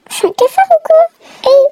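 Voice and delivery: hammed-up high-pitched outrage at being ignored; squeaky self-pity. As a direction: squeak it out like an offended little creature. Player ignored, feels like cockroach.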